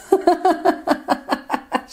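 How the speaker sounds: high bright burst of laughter; delighted laugh. Real, not acted. Reader laughing with delight over the sun card.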